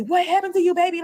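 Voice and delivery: loud, high alarmed cry; the boyfriend panicking over the phone; worried outburst. Retold or imitated. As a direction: sugar daddy shouting in worry. Sugar daddy worried on phone.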